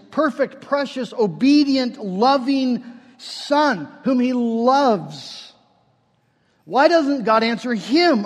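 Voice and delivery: urgent questioning from the pulpit; impassioned preaching. Real, not acted. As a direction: preach it hard, leaning on the key words. Preaches with rising force.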